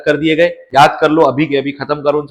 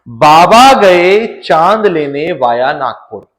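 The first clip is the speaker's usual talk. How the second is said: slow, each word laid out for memorising; teacher spelling out the trick for students, slow and deliberate; slow mnemonic; much slower than usual. Shown as a demonstration. Teacher to class, reciting the mnemonic slowly. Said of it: "एक sentence बस आप याद कर लें".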